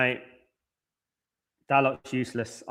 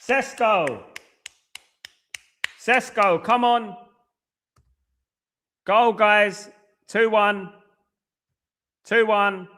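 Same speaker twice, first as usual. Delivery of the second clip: high, loud cheers for the scorer; excited cheering; 7 semitones higher, 4 dB louder, much slower than usual. Real, not acted. Cheering a goal on television.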